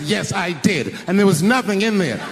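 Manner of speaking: mock-indignant scolding; imitated angry wife. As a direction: confess defiantly as a mad wife. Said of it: "she was mad at me".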